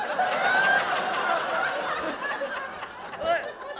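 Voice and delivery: long high helpless laughter. Real, not acted. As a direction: collapse into laughter. Long laugh at disintegrated tin.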